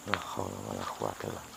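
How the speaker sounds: low, fast, soft prayer mutter; fast, hushed prayer. Real, not acted. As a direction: rattle off the prayer under your breath.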